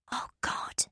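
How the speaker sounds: breathless whispered gasp; whispering. Acted. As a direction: whisper it in shock. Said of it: "Becky whispers".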